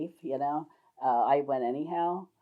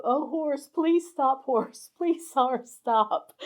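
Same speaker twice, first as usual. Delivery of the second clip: high, frantic begging with laughter breaking in; panicked pleading; 10 semitones higher, much slower than usual. Retold or imitated. Riding a runaway horse, she begs it to stop in high, panicked pleas.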